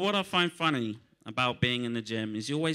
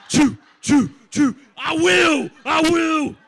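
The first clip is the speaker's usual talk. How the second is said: hype man shouting; 11 dB louder, much slower than usual. Retold or imitated. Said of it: "irrelevant friend just sitting next to them, just going".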